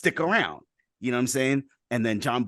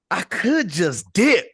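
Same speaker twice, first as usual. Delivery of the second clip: high, loud, drawn-out comic musing; tempted musing; 8 semitones higher, 6 dB louder, slower than usual. Acted. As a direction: float the idea slowly, pitched up, as the president. Acts out the tempted president.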